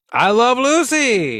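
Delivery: high, slow, delighted call of the title; excited exclamation. Real, not acted. Friend recalls an old sitcom in a high delighted cry. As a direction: belt out the show name with glee.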